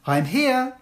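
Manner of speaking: lightly voiced child answering the register; a hint of a pupil saying present. Pupil answering teacher's roll call, lightly voiced child.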